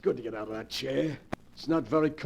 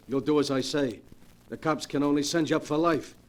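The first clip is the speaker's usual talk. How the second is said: ordinary talk, nothing marked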